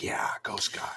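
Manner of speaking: half-whispered rooting cheer; hushed cheer. Retold or imitated. Podcaster retelling a film's action to a co-host, hushed cheer. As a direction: cheer under your breath.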